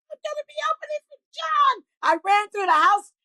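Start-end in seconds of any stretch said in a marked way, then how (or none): 0.1-1.8 mock excited scream. Woman telling the house she'll open for a star, high mock scream. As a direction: squeal the news as a held-back scream. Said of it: "And I started screaming".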